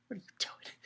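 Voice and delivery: hushed, voiceless aside; whispered disbelief. Retold or imitated. Reader reacting to the silly lust act, whispered disbelief. His stunned reaction to the boy.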